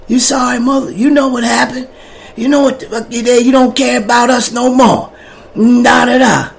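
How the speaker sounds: lightly voiced angry wife snapping back. Wife angrily answers on phone.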